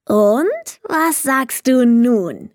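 slow, drawn-out hopeful question; fishing for praise, stretched out slowly; proud little kobold waiting for praise; expectant. A narrator's reading. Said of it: "fragte er erwartungsvoll".

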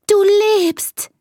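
amazed, relieved cry; joyful little outcry; calling out. A narrator's reading. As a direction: exclaim it to a pet found alive. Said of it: "ruft Elisa".

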